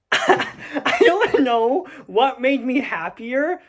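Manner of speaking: slow words broken by real laughter; giggling while admitting it; laughing. Real, not acted. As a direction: laugh through it, amused at yourself. Laughing recalling being right.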